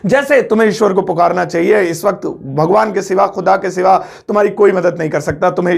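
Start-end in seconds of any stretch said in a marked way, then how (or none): none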